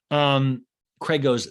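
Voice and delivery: ordinary talk, nothing marked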